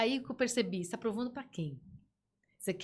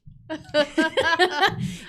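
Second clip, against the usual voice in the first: loud high burst of laughter; bright amused laugh; 6 semitones higher, 10 dB louder than usual. Real, not acted. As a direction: crack up at your own idea. Laughs at her own coach idea.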